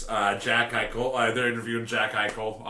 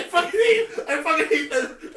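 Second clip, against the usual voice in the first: much higher, cracking with laughter; laughing outburst; 4 dB louder than usual. Real, not acted. Cohost reacts to the impression, laughing high. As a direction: protest while cracking up. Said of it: "that impression".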